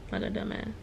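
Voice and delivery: soft unvoiced mutter at herself; whispered self-insult. Real, not acted. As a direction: breathe it out under the breath. Self-mocking aside.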